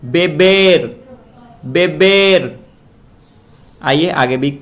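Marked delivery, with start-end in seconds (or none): 0.1-2.6 बेबेर with a high, loud, long बेर; stress on last syllable. Shown as a demonstration. Teacher recaps the rule, stressing बेर in बेबेर. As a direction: lift and hold the बेर of बेबेर. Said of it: "last syllable पे डालें".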